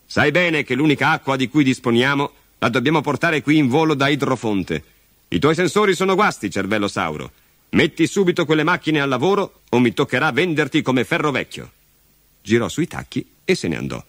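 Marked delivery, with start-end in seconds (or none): 0.0-11.7 raised-pitch, impatient telling-off; irritated scolding. Acted. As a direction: boss reprimanding a faulty robot. Father scolds and threatens the robot. (rimprovero seccato e acuto)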